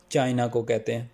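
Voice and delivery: normal talking voice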